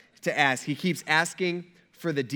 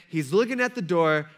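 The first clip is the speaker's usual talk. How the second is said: ordinary talk, nothing marked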